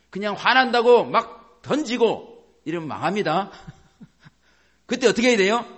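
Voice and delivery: ordinary talk, nothing marked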